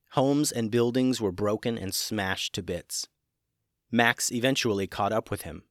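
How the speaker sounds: usual speaking voice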